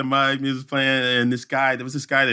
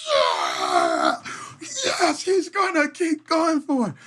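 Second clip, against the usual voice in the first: mock crowd cheer, much higher; much slower than usual. Crowd cheers on the injured runner, high mock cheer. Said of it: "Everybody went".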